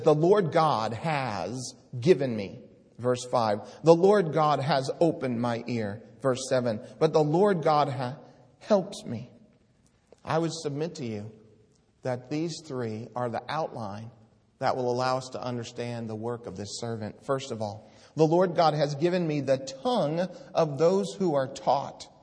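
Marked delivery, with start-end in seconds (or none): 0.0-9.3 loud ringing Bible reading, pitched up; declaiming God's words from the pulpit; scripture proclaimed. Acted. Reads three verses forcefully. 18.1-22.1 loud ringing Bible reading, pitched up; declaiming God's words from the pulpit; scripture proclaimed. Acted. Reads verse four loudly.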